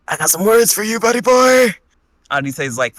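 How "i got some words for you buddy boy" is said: much higher and louder mock challenge; calling out threat. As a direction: holler it across the yard at him. Malik calls out Arite outside.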